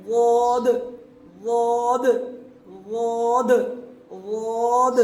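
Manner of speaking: a heavy emphatic واد; emphatic daad. Shown as a demonstration. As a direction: push واد out from the side of the tongue. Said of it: "وہاں جا کر لگ رہا ہے".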